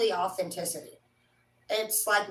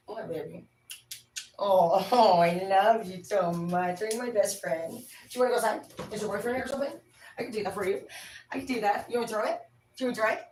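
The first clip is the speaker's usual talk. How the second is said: pet talk; faster than usual. Real, not acted. Talks lovingly to her pet.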